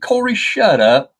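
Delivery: high, drawn-out mock scolding; retold scolding. Owner hushing the squawking crow, retold scolding. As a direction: tell off a noisy pet, slow and high. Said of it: "And I said".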